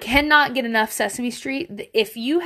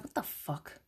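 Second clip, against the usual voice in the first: breathy under-the-breath "what the fuck"; whispered scoff; 6 semitones lower, 17 dB quieter than usual. Retold or imitated. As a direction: scoff it in a whisper, half to yourself. Scoffing at a Reddit question.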